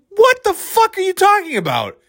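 very high-pitched incredulity; exasperated outburst. Real, not acted. Host reacting to a clueless judge, exasperated. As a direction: burst out in high, baffled outrage.

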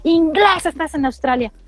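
high, slow, scolding imitation of a local; slow mocking bark at a foreigner; mock rude Australian. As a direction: ape the snippy commuter telling you off. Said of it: "Unos tren".

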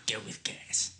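soft whisper, repeating the order; whispered echo. Retold or imitated. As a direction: hush it like a secret.

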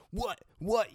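high, loud in-your-face What? What?; aggressive mock challenge. Mimicking a confrontational guy getting in a smoker's face, high and loud. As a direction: bark it like a bully puffing his chest. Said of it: "get up in your fucking face".

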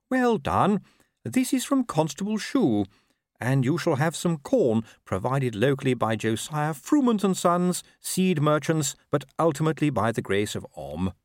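pious constable, pleased. Acted. Constable to his pigeon as he feeds it, pleased and pious. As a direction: praise a pigeon in an earnest constable's voice.

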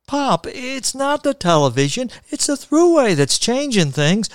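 high-pitched protest to Pop; insistent son correcting his father; exasperated arguing. Acted. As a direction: argue back, voice pitched well up.

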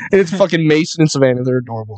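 ordinary talk

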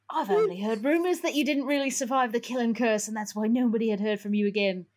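put-on accent that veers into Australian. Old man telling Harry about rumors, wobbly Australian accent. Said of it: "I'm getting into Australian".

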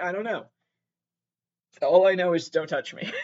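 ordinary talk

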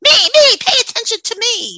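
put-on high, loud greedy child voice; whiny toddler. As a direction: whine like a demanding toddler. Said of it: "They're running around going".

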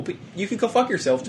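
high-pitched playful dig; mock-sweet insult. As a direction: lift it high, joking put-down. Repeats the comic insult.

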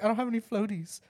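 a hint of a small boy's voice; lightly voiced child. Kid explains to dad, lightly imitated child. Child objects to ocean threat.

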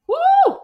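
high loud excited whoop. Real, not acted. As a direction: let out a wild woo. Remembering her riled-up past self.

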